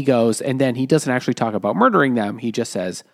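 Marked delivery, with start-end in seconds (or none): none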